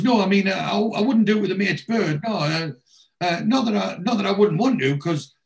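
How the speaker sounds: high, halting, backtracking excuses; flustered babbling. Retold or imitated. Wayne digging himself a hole with Brenda, babbling. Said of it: "starts digging himself a fucking hole".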